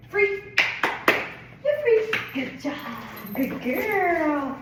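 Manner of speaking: very high, loud, delighted praise; squealing dog praise. Real, not acted. Trainer rewarding her dog with high squealing praise. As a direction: gush over her, high and thrilled.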